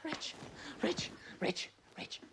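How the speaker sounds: hushed urgent whisper; whispered calling. Acted. Paul whispering to rouse Rich, hushed. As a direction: whisper his name again and again. Trying to wake Rich quietly.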